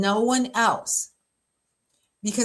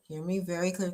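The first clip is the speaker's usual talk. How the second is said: usual speaking voice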